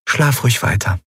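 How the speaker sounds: ordinary delivery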